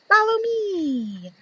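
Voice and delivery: high, slow little bee calling. Acted. Bee leads the others, high and slow. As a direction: draw the others along like a tiny guide bee.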